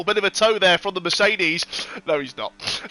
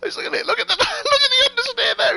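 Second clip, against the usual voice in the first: laughing. Real, not acted. Laughing at the car.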